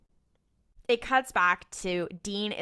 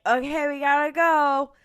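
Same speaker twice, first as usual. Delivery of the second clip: high, loud mock urgency; rally them reluctantly to get moving; hammed-up pitched-up okay; urgent resolve; 7 semitones higher, 9 dB louder than usual. Retold urgency at the sewer.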